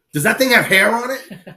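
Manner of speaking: high-pitched mock disbelief; appalled butcher. Butcher asking about the pig in the cooler, appalled. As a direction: imitate a butcher aghast, voice jumping up. Alarmed butcher acted in story.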